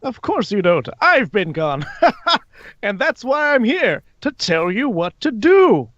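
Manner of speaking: high, swooping theatrical villain; high character voice, grandly persuasive; Alastor, smugly reassuring. Acted. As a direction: lilt it high with showy confidence. Plays Alastor.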